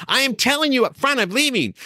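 high, emphatic mock ultimatum; getting madder. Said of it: "getting madder and madder".

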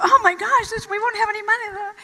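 high, loud mock panic; imitating herself panicking about money; panicky. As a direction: blurt it out in rising alarm. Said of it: "I started to get very panicky".

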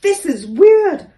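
high, loud startled exclamation; weird thought. A narrator's reading. Door startled by the vanishing elephant, loud and high. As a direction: blurt the surprise. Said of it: "He thought".

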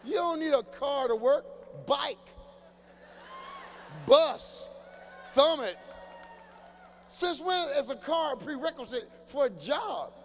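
high loud disbelief; incredulous shout. Real, not acted. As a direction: yell the obvious at him.

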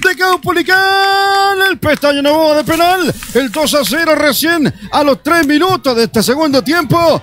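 long stretched goal shout; goal call. Real, not acted. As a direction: ecstatic goal announcement. Goal call after penalty.

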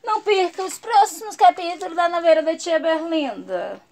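mock TV announcer's trailer read; playful, projected telenovela teaser; soap promo announcer. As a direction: announce the next episode like a promo.

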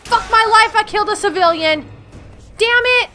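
high, loud panicked rant; frantic yelling. Real, not acted. Gamer after killing a civilian, loud frantic self-blame. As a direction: yell at yourself in a panic. Accidentally killed a civilian in game.

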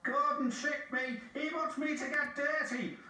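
high-pitched indignant engine voice; high, cross outburst with wounded pride; furious. A narrator's reading. James tricked by Gordon, furious. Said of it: "James was furious".

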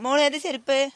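high, loud call across to daughter, rising at the end. Real, not acted. Mother calls to her daughter asking which sandals, loudly. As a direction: raise the voice on ഏത് to reach her. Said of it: "ഏത് ചെരുപ്പ് ഇട്ടെന്ന്".

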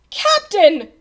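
high, loud appeal; pleading. A narrator's reading. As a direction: call out imploringly. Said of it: "pleaded Bartholomew".

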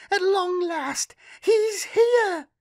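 put-on very high, loud, delighted girl; gleefully. A narrator's reading. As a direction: squeal it with glee. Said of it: "she said gleefully".

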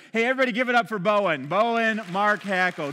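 loud, quick call for applause; rally the crowd like an emcee; rousing announcement. Real, not acted. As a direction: call out big to the audience. Calls audience to applaud child.

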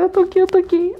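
high-pitched, relieved repeating; imitated girl's high voice; emotional reassurance. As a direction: promise them you are back. Rescued girl reassuring friends.

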